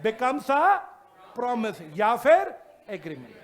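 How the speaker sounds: stretched words rising loud at the end; slow emphatic dictation. Shown as a demonstration. Teacher dictating how a proposal becomes a promise, slow. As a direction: spell the definition out slowly. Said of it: "dictating definition to class".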